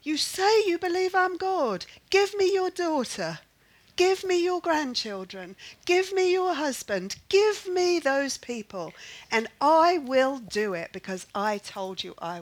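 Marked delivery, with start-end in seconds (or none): 0.0-8.9 high, insistent voice of God; insistent demand. Retold or imitated. God demanding she hand over her family, insistently. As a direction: press each demand in a raised pitch. God's challenge voiced to a worrier.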